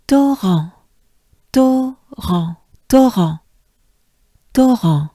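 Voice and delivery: torrent as to-RAHN, breathy R, nasal end; French torrent. Shown as a demonstration. Teacher drills torrent, loud and clear. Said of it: "with a good breath to start".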